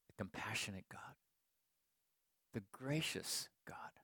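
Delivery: soft, halting read of sacred words; hushed reading aloud. Retold or imitated. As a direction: imitate her reading, softly and near a whisper. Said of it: "here's what she read".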